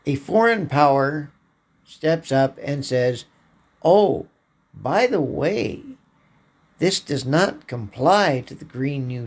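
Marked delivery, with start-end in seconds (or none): none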